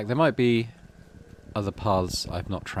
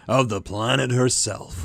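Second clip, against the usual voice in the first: higher, louder and slow, booming; pompous governor; 4 semitones higher, 4 dB louder, slower than usual. Acted. Governor Lum addresses the colony square with a booming pompous speech. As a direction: orate grandly to the assembled colonists. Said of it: "he makes a ringing gesture".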